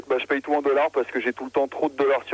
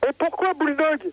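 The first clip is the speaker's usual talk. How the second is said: très aigu pour rire; voix de fausset; 13 semitones higher than usual. Acted. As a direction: pousse une voix suraiguë. (voix de tête comique)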